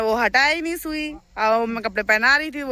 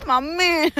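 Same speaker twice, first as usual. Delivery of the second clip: laughing call for mom; 5 semitones higher, much slower than usual. Real, not acted. Frightened by cow, calls mother.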